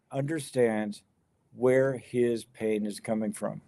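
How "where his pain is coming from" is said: ordinary delivery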